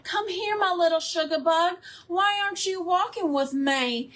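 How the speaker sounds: hammed-up Southern mama from Georgia; Georgian accent. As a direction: coo to a child in a Georgian accent. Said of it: "speak with a Georgian accent".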